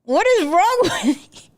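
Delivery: loud, high, laughing rant; laughing mock outrage. Real, not acted. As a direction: roast him while cracking up.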